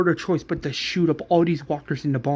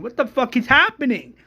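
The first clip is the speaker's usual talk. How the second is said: higher, louder, alarmed repeat; shocked outburst; 8 semitones higher, 6 dB louder than usual. Acted. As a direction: cry out in disbelief. Bystander's shocked cry, repeated louder.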